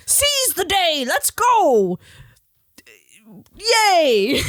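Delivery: mock hyped-up cheer, high and drawn out; toxically positive. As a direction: parody an over-motivated cheerleader type. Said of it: "like, toxically positive".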